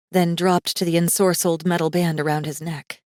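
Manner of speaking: ordinary delivery, nothing marked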